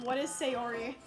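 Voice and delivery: ordinary talk, nothing marked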